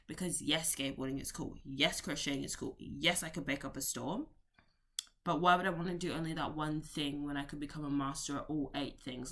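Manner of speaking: usual speaking voice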